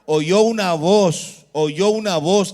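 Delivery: loud, slow, emphatic scripture reading; stretched-out, forceful proclamation. Shown as a demonstration. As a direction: project each word to the whole congregation. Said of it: "Léalo más fuerte".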